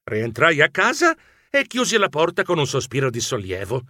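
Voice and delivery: ordinary delivery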